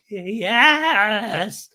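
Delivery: high, loud, stretched-out squeal; Raquel's mock acceptance. Recapper imitating Raquel accepting a proposal, hammed-up joy. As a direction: imitate an overjoyed bride-to-be.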